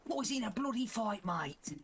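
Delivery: high-pitched imitation of a British game soldier; mock British accent. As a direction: echo the soldier in exaggerated British. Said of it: "he's got a British accent".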